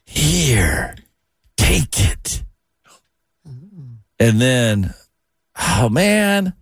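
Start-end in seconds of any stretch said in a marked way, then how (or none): 0.0-2.4 lightly voiced creepy clown; a half-hearted, drawn-out clown lure; terrible clown impression, words spaced apart; Pennywise. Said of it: "The first one was Pennywise".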